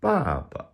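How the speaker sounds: BABA high first syllable, second drops off; neutral-tone ending. Shown as a demonstration. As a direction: let the last BA of BABA fall away toneless. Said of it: "Some other examples".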